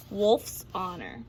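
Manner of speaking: soft, slow confidential promise; murmured oath under the breath; hushed aside. A narrator's reading. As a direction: lean in and swear it quietly. Wolf's aside swearing truth.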